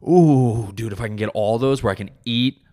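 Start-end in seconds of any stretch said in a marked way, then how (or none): 0.0-0.7 loud ooh of bliss; pleasure moan. Acted. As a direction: moan with delight.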